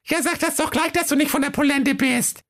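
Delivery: put-on very high, squeaky kid voice; high-pitched bratty little goats; little goats calling. A narrator's reading. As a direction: pipe up like cheeky goatlings. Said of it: "Da riefen die Geißlein".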